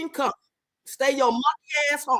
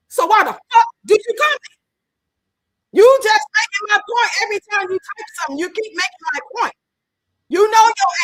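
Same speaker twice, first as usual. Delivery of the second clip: loud and high, fired up at the commenters; heated yelling; 6 semitones higher, 8 dB louder than usual. Real, not acted. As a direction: shout it, heated and exasperated. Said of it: "hear the passion in my voice".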